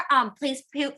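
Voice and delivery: usual speaking voice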